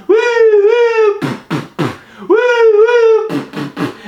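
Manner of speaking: imitated police sirens. Storyteller stepping into chaos and gunfire, imitated sirens. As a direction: wail like a siren, then puff. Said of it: "Police sirens are going off. It's like this".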